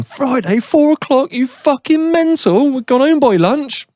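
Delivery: high-pitched mock yell of outrage; mock screaming. Listeners screaming at him for leaving early Friday, mock screaming. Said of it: "probably screaming at me going".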